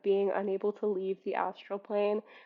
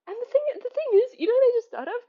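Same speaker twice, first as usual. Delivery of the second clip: high, fast excited burst; excited realization; 12 semitones higher, 6 dB louder, much faster than usual. Real, not acted. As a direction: blurt the sudden idea. Sudden realization.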